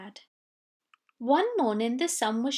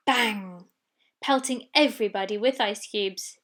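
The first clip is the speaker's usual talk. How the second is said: ordinary delivery, nothing marked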